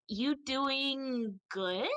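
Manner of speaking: socially awkward small talk, dragged slowly upward. Acted. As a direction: stretch it out awkwardly, rising at the end. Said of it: "the most awkward way to respond".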